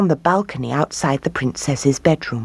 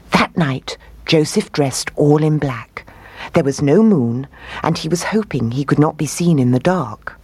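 usual speaking voice

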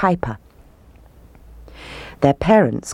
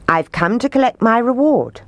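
lightly voiced piper, pitched up; Pied Piper; 7 semitones higher than usual. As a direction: claim it in a higher voice. Piper claims his reward.

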